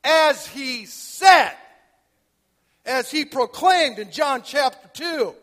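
loud, high, slow proclamation from the pulpit; fiery preaching. Acted. Preacher proclaiming the resurrection to his church, booming and slow. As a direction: thunder it out to the congregation.

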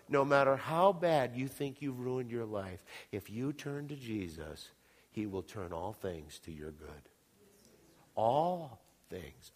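normal talking voice

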